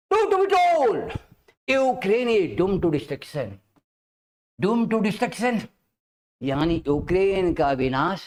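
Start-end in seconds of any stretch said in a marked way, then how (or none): 0.1-5.6 very slow, word by word, for students to write down; spelling each phrase out slowly; slow dictation. Shown as a demonstration. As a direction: dictate it like a teacher giving notes. Teacher dictates line for notes.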